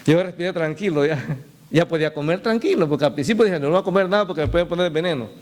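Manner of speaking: ordinary talk, nothing marked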